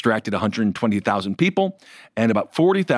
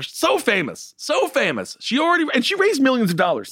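high-pitched, exaggerated emphasis; mock amazement; 13 semitones higher than usual. Real, not acted. Host mocks a celebrity's poor polling, high incredulous emphasis. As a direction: pitch it up for comic disbelief. Exaggerating the candidate's fame in mockery.

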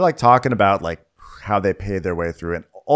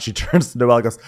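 ordinary delivery

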